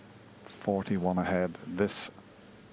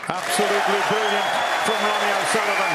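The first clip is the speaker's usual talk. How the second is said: high, loud, thrilled call; excited commentary; 12 semitones higher, 8 dB louder than usual. Real, not acted. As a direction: shout it over the crowd roar. Century completed, crowd roaring.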